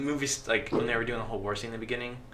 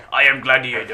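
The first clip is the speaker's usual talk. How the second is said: loud, hammed-up gladiator boast; booming defiant gladiator; 10 dB louder than usual. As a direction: bellow it like a mock arena hero. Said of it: "My gladiator voice".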